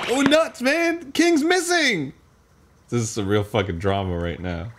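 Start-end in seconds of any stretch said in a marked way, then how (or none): 0.1-2.1 high, loud, over-the-top panic; mock alarm. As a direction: cry out in hammed-up shock. Mock alarm at game's story.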